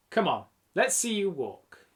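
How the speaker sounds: plain reading voice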